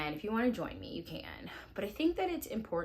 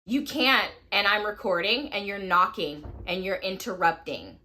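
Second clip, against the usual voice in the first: raised, higher voice, fed up; exasperated scolding; 4 semitones higher, 11 dB louder than usual. Real, not acted. Mom to someone knocking while she records, exasperated scolding. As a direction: scold an interrupter, louder and exasperated.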